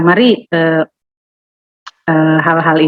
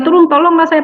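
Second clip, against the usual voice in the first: higher, begging voice of a desperate widow; pleading widow; 8 semitones higher than usual. Retold or imitated. (janda memohon dengan suara tinggi dan memelas)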